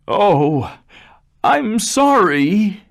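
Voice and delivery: high, loud, slow cry for mercy; frightened plea. Acted. Terrified father begging the beast for mercy, high pleading cry. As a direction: beg on your knees. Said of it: "he cried".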